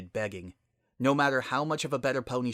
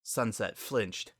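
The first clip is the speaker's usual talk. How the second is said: ordinary delivery, nothing marked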